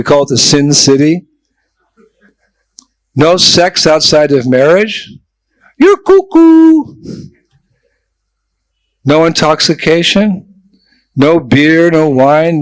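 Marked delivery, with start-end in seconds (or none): 5.8-7.0 high, loud, slow mocking squeal; hammed-up disbelieving Texan; mock Texan retort. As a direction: scoff like a shocked Texan hearing the rules. Imagined Texan's reaction to rules.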